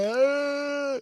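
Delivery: high, drawn-out imitated wail; mock ugly cry. Dad imitating his daughter breaking down, ugly-cry wail. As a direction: fake a child's bawling cry. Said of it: "like, ugly cry, face so like".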